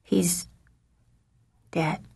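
cracked, shaky whisper; voice cracking. A narrator's reading. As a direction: voice breaking on the words. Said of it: "his voice cracked as he tried to speak".

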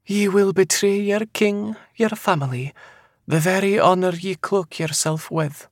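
high Scottish seer voice; eerily certain. A narrator's reading. Seer foretelling betrayal to the man, eerily certain. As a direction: deliver the dark prophecy high, without doubt. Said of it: "in a voice so eerily certain".

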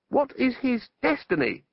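high-pitched, strained demand; mild shout, pitched far up. A narrator's reading. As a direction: call it out high over a gale. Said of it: "shouted Ipslaw above the rising gale".

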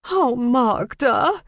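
high, slow wail; drawn-out tipsy dismay; tearful exclaiming. A narrator's reading. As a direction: cry out on the edge of tears. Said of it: "exclaimed again and burst into tears".

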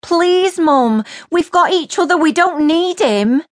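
high, loud tearful begging; desperate pleading. A narrator's reading. Chantel begging her mum, pleading.

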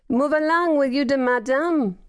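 put-on high, heavy French-accented voice. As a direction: push him along in a thick French accent. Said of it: "the French perfumer".

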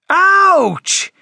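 high, loud "ouch" wail; wail of pain. Acted. Siri smacked round the ear by a skateboard, pained wail. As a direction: wail it out, pitched right up. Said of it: "wailed Siri".

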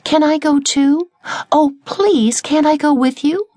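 high, begging caterpillar voice; pleading little caterpillar. A narrator's reading. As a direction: beg like a tiny hopeful child. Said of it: "Sylvia asked".

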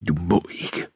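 hushed, husky protest; soft, urgent near-whisper; hoarse whisper. A narrator's reading. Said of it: "hvisker hun hæst".

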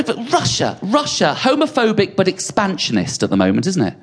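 usual speaking voice

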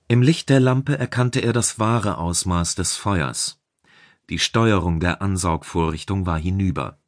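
plain reading voice

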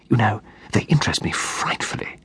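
hushed, breathy gossip whisper. A narrator's reading. Pamela gossiping quietly about the couple nearby, whispered. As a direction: whisper it so the couple can't hear. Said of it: "her voice just lowered sufficiently".

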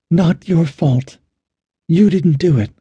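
high-pitched, weakly angry protest; weak anger. A narrator's reading. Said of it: "in weak anger".

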